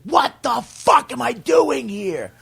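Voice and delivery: high, slow exasperated shout; fed-up outburst. Retold or imitated. Comic yelling in parking lot, slow exasperated shout. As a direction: yell it out at the empty lot. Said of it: "he was like".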